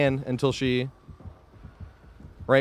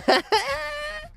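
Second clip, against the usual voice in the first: high squeaky laugh. Real, not acted. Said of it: "like, an inhaled helium".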